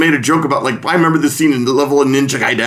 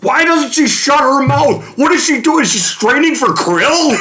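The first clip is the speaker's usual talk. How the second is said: high-pitched exasperated mock rant; hammed-up fed-up outburst, words stretched; Bill Corbett outburst; 12 semitones higher, slower than usual. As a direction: rail at the screen in comic exasperation. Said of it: "like, bursts of anger".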